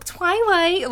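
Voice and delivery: high-pitched eager chorus voice; high, falling mock cheer; mock group cry. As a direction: exclaim it high, imitating everyone's obsession. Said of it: "everyone just was like".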